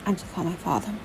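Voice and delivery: soft, injured man's voice; pained speech. A narrator's reading. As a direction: speak softly, as if it hurts. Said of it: "it was painful for him to speak".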